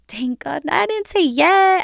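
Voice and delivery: high, slow burst of relief; relieved. Retold or imitated. Recalling the breakup text, high and relieved. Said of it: "I was like".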